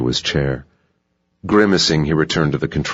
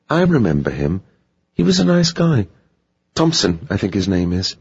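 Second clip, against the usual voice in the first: higher-pitched, chatty receptionist voice; 5 semitones higher than usual. Acted. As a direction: recall a guest as the hotel receptionist.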